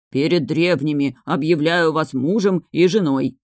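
usual speaking voice

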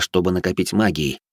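ordinary delivery

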